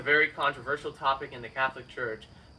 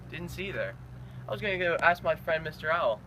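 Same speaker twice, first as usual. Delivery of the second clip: usual speaking voice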